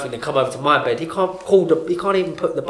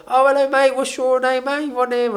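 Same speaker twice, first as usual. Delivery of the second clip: put-on much higher, cheery old-man greeting; chatty old fella; 12 semitones higher than usual. Old ward neighbour greeting the new patient, cheery high voice. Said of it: "He's like".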